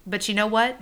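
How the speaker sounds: ordinary talk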